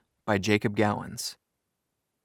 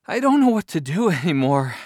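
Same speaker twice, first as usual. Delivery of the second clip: voice cracking; 6 semitones higher, 4 dB louder than usual. A narrator's reading. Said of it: "his voice cracked".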